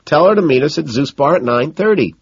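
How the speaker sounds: high excited calling; eager, high and loud. A narrator's reading. Van Patten calling excitedly.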